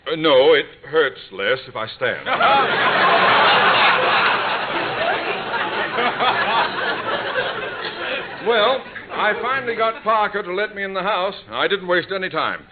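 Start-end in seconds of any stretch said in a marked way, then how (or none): 2.3-8.4 long, high breathy laugh; drawn-out high airy chuckle; embarrassed laugh. Acted. Laughs off the dog bite.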